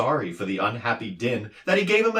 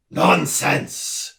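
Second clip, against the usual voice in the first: roared; 7 semitones higher than usual. A narrator's reading. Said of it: "the doctor roared".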